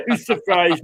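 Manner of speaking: "Who's surprised" said through real laughter; laughing repeat. Real, not acted. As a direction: laugh at your own jibe as you say it again.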